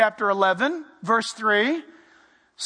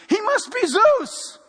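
high, slow gushing awe; awestruck crowd; 10 semitones higher, slower than usual. Acted. Crowd deciding who Barnabas is, awestruck. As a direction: marvel at him like a god. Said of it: "And they named Barnabas Zeus".

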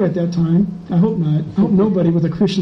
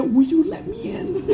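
pleading; 10 semitones higher, 5 dB quieter than usual. Retold or imitated. Begging through car window.

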